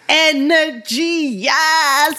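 high, drawn-out, gleeful hype; hyped-up cheer. Acted. Mum cheering on her son's confidence, hyped-up. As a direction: cheer it on with long vowels.

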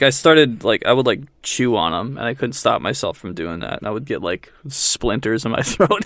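ordinary talk, nothing marked

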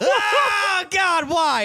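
high, anguished cry of disgust; disgusted wail. Retold or imitated. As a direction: whine it like it's the worst day ever. Roommate disgusted after the smell.

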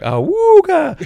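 high hooting wooga; a cartoonish ogling call; mock wolf call. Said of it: "as a joke, be like".

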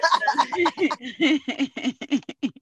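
real drawn-out cackle of laughter; real laughing fit. Real, not acted. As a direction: crack up helplessly at your own telling. Cracks up at own poor retelling.

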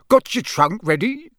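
high, cheery question; bright. A narrator's reading. Wizard to boy ready to leave, brightly. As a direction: ask eagerly with a lift. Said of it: "said Mister Weasley brightly".

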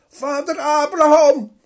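luide roep, veel hoger en harder; roepend. Acted. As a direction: roep wanhopig naar boven. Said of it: "riep de rijke man". (rijke man roept vanuit de hel naar Abraham)

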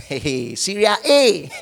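ordinary delivery

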